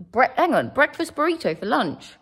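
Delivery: surprised. Real, not acted. Incredulous at partner's lunch order.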